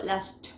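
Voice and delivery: LAST ending in a CH sound; LAST stopped on "shch". Shown as a demonstration. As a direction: turn the final T of LAST into CH. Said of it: "y queda ese ch".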